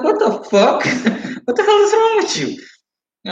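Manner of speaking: high, laughing what the fuck; cracking up while calling him out; retold outburst broken by laughter; laughing disbelief. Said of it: "just made me laugh".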